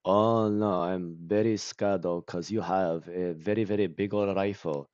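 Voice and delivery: badly acted English in a heavy Japanese accent; imitated Japanese accent. Movie fan mocking actors who don't understand their lines, heavy Japanese accent. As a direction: recite it like memorized sounds, Japanese-accented, with no real fear. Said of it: "They don't even know how to act with the things that they're saying".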